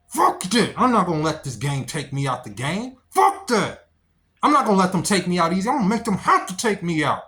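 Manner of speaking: loud, high defiant outburst; defiant shout. Real, not acted. Urging men to fight on, loud defiant yell. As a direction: spit it out in defiance of the odds. Defiant vow against giving up.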